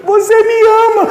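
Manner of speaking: high, sweet, teasing wife voice; wife teasing her crying husband in a high sweet voice; imitating his wife. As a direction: mimic the wife high and coy. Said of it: "falou desse jeito pra mim".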